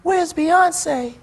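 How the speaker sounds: high, hushed star voice; lightly voiced high imitation, not truly whispered. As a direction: imitate a shy celebrity asking. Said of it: "he whispers in my ear".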